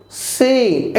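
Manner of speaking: সিন with a smooth long vowel; drawn-out, gentle. Shown as a demonstration. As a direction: ease through সিন, holding the vowel. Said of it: "একটু টেনে মোলায়েমভাবে বলবেন". (সিন টেনে মোলায়েমভাবে)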